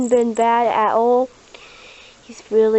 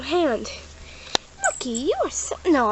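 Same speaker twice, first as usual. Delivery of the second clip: ordinary talk, nothing marked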